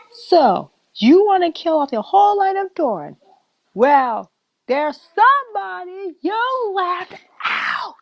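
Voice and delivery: high, theatrical challenge to a villain; confrontational mother. Acted. Mother confronts villain over threat.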